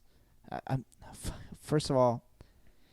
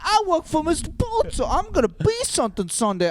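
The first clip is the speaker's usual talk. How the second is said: much higher, louder, laughing naive dreamer voice; mock naive worker; 11 semitones higher, 5 dB louder than usual. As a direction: squeal it like a starry-eyed idealist. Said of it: "You know".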